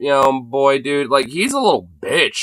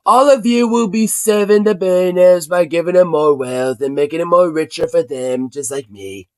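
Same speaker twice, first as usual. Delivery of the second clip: parody speech. Retold or imitated. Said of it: "parodying the president's speech".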